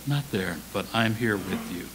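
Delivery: low, soft reassurance; careful, gentle God. Acted. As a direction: speak carefully, as to someone fragile. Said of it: "God spoke so carefully to him".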